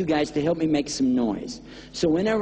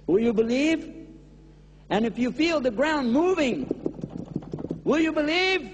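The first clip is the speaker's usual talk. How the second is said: pleading build; 6 semitones higher than usual. Acted.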